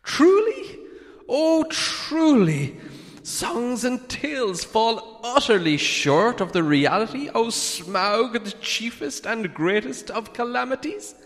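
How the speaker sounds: frightened hobbit flattering the dragon, high and nervous. A narrator's reading. Bilbo voice.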